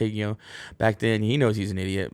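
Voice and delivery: normal talking voice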